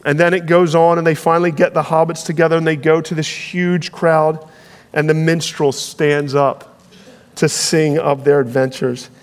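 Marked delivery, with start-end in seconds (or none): none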